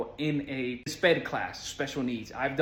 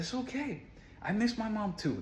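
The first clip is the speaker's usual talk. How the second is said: soft, high consoling voice; hushed, lifted adult-to-child reassurance; soothing comfort; 6 semitones higher, 7 dB quieter than usual. Retold or imitated. Retold comforting during a hug.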